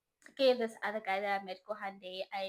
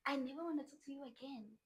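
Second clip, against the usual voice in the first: higher, soft-voiced echo of his words; quiet, raised-pitch mimicry; mock repeat; 4 semitones higher, 7 dB quieter than usual.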